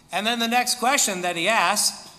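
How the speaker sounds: ordinary talk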